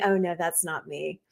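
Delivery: ordinary talk, nothing marked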